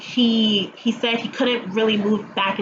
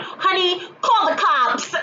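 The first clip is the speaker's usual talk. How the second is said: a neighbor yelling to his wife from inside; mock alarmed call from inside the house, a bit higher; voice from the background; 8 semitones higher than usual. Homeowner calls to his wife, mildly alarmed.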